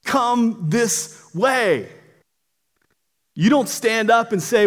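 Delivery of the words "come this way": very slow, higher, each word drawn out; calling voice. Acted. Voice guiding someone out of a fire, long drawn-out call. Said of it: "The voice says".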